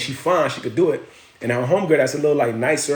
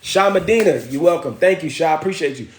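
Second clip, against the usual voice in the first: ordinary talk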